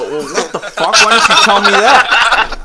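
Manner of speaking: laughing, rising to a loud slow shout; incredulous yell. Retold or imitated. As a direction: shout in disbelief through laughter. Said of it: "I go".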